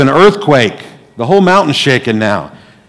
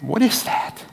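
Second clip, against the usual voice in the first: high, soft disbelieving question; as if hardly believing it; stunned disbelief; incredulous; 10 semitones higher, 9 dB quieter than usual. Real, not acted. Incredulous reaction to Nazis line.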